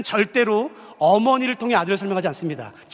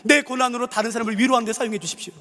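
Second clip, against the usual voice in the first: higher, earnest prayer voice; 6 semitones higher than usual. Shown as a demonstration. Pastor modelling a prayer for the congregation, earnest. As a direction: lift the words up as a plea. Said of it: "이런 기도하고 싶은 분".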